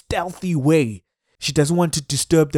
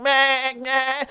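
high bleating meh like a goat; 9 semitones higher than usual. Retold or imitated. Imitating the crying buffalo calf with a high bleat. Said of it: "It's bleating like a goat".

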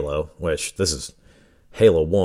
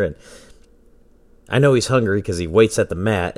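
usual speaking voice

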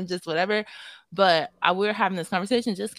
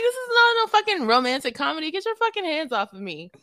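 very high, worked-up mock outrage; shrieky indignant retelling; retold telling-off; 10 semitones higher, 4 dB louder than usual. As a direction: act out a furious brush-off, hammed up.

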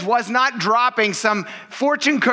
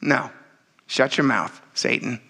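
stern Jesus rebuke; 6 semitones lower, slower than usual. Retold or imitated. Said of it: "Jesus is like".

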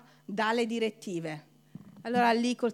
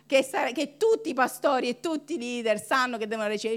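normal talking voice

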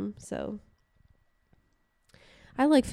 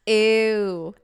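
disgusted; 8 dB louder than usual. Real, not acted. Reacting to a dirty joke.

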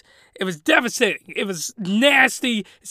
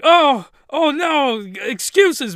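high, slow mock panic; 6 semitones higher, 5 dB louder, slower than usual. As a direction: wail it like a caught-out kid. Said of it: "I was like".

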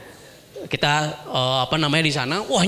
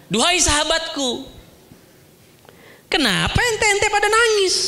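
a hint of a higher, tender questioning voice; gently voiced Prophet; 12 semitones higher than usual. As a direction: Prophet asking his companions why they weep. Prophet asks companions why crying.

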